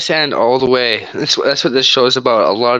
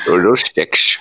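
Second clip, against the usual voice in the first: put-on East Indian accent; 5 semitones lower than usual. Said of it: "I'm doing an East Indian accent to mock them".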